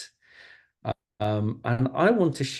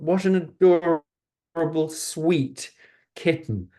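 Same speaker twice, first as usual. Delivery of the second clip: gushing admirers; 5 semitones higher, 3 dB louder than usual. Acted. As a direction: coo like grown-ups admiring a kitten. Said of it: "everybody who saw Max thought".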